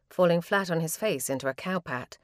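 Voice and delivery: plain reading voice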